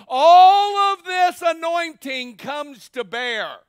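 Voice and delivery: loud, high shouting sermon climax; full-force preaching. Acted. Preacher shouting to the congregation, full-force preaching. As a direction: build to a shouting peak.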